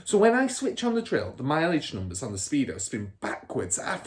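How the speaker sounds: put-on a smug car dealer bragging to his kid; Mr Wormwood's voice. A narrator's reading. Father and Michael voiced.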